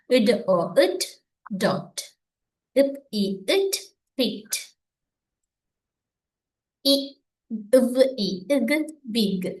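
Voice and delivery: sounds apart, as in "இட், ஓ, இட்", then "டாட்"; isolated sounds with gaps, then whole words like "விக்"; phonics blending. Shown as a demonstration. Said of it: "இதுல என்ன செய்யணும் பாருங்க".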